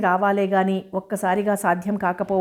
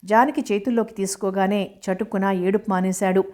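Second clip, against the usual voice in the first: usual speaking voice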